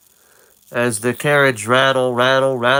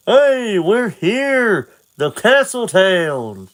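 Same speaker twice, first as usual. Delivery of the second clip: excited call; 7 semitones higher, slower than usual. Acted. Talon calls back to Link, high excited call. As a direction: shout it out as the town comes into view. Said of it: "looks back and says".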